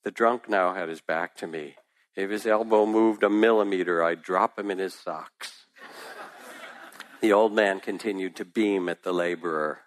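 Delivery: plain reading voice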